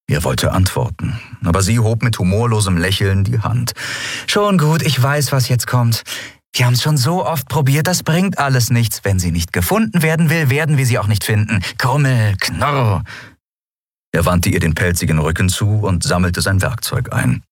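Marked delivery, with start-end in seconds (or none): 4.3-13.0 put-on higher young woman, pleading; a young captain pressing her friend; Endriel's voice. A narrator's reading. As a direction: lift into Endriel, urgent and insistent.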